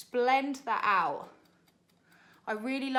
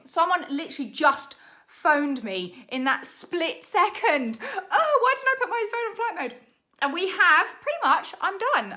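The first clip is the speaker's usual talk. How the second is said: much higher and louder, flustered and laughing; flustered panic; 7 semitones higher, 9 dB louder than usual. Real, not acted. To viewers after a call interrupts her timed challenge, high flustered panic. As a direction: blurt it out in a laughing panic as the phone rings. Phone rang mid challenge.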